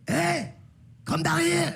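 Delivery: high summoning call, imitating dad. Dad summoning nephew angrily.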